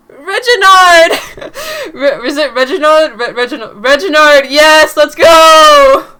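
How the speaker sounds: very loud, drawn-out cheering with laughter; real excitement. Real, not acted. Reader greeting a returning character, shouting with real excitement.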